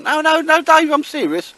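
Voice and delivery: telling Dave the price is real, loud high insistence; insistent protest. Real, not acted. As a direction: yelp it out, insisting you're serious. Insisting to Dave he's serious.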